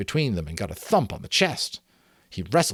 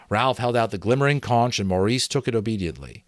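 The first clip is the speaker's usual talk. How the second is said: usual speaking voice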